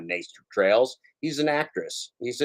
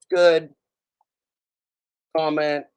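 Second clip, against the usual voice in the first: loud exclamation; 5 semitones higher, 4 dB louder, slower than usual. Real, not acted. Sudden loud approval.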